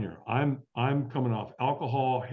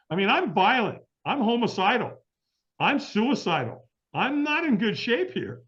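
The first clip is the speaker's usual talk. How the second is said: much higher, slowed, exasperated outburst; furious rant; 12 semitones higher than usual. Retold or imitated. Withdrawing addict snapping at the early caller, furious. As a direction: vent like you'd strangle the caller. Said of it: "I'm like".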